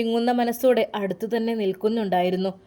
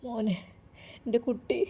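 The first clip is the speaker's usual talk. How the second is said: crying; 8 dB quieter than usual. A narrator's reading. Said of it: "Ayishumma കരയാൻ തുടങ്ങി".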